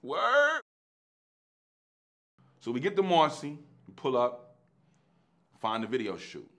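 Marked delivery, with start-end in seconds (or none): 0.0-0.6 high, loud squeak of disbelief; a stunned high "Word?"; surprised. Retold or imitated. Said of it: "His face was like".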